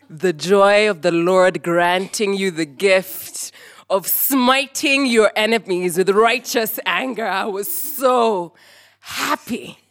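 slow, loud, gloating rejoicing; exultant glee. Retold or imitated. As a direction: proclaim the triumph, drawing the words out. Relives gleeful triumph over brothers.

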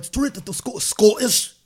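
put-on Scottish accent. Retelling his train pranks, a lightly faked Scottish accent. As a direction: slips straight into a hint of Scottish. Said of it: "I can't keep this accent up".

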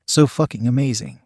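plain reading voice

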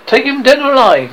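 high, loud captain's commands; shouted orders. A narrator's reading. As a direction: shout orders to the crew, pitched well up. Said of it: "Hook shouted".

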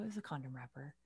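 low, very soft retold answer; retold secret, dropped far down in volume; hushed confession. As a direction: confide it under your breath.

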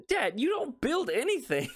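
high-pitched exasperated protest; retold exasperation. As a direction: protest to Dad, pitched well up. Retold argument with dad over tools.

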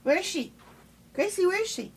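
high, soft dog talk. Real, not acted. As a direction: coo to the other dog. Asking dog where pup is.

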